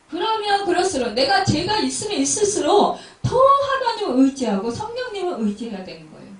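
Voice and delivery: loud, rising sermon voice; fervent preaching. Acted. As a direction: preach it hard to the congregation. Preaching at full force. (힘차게 외치는 설교)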